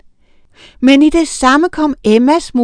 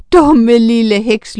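loud, slow taunt; drawn-out gloating sneer; mocking laugh; 6 dB louder, slower than usual. A narrator's reading. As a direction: jeer as if laughing at her. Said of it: "lå hun".

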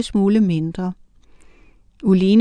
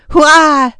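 loud, high cheer; loud high hurrah; 6 semitones higher, 8 dB louder than usual. A narrator's reading. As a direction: cheer with a crowd of little witches. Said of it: "lød det fra alle de andre".

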